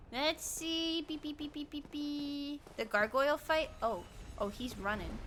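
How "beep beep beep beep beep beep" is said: soft little beeps; quiet, repeated beeps under the breath; imitated beeping. Imitated beeps while moving along.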